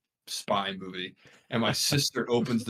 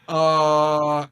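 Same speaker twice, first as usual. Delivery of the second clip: loud, higher, drawn-out "uh"; guilty interjection; 5 semitones higher, 8 dB louder than usual. Retold or imitated. Culprit interrupts cop and family, guilty interjection. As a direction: blurt a long hesitant attention-getter.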